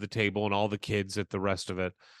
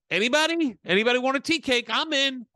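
much higher and louder, breezy hammed-up offer; mock chipper; 11 semitones higher, 4 dB louder than usual. Offering tea cakes to a silent table, high mock chipper voice. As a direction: play the clueless guy pushing dessert. Mimicking own cheerful offer.

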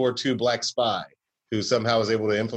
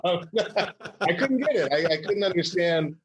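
laughing; 7 semitones higher than usual. Real, not acted. Laughing after accent joke.

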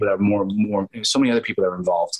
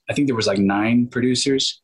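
ordinary talk, nothing marked

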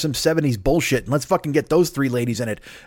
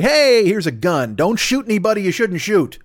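higher-pitched mock welcome; mock presenter; 6 semitones higher than usual. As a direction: hand it over with put-on cheer. Mimics team handing over guns.

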